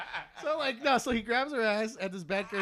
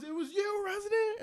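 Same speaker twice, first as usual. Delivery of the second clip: mock accusing yell; 12 semitones higher than usual. Brother accusing him.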